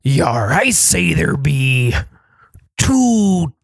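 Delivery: gruff slow pirate drawl. Shown as a demonstration. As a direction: growl it like an old sea dog. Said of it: "and in pirate voice, of course".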